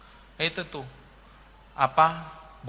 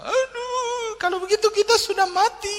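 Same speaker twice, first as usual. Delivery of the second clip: mock crying. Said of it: "Orang India nangis semua".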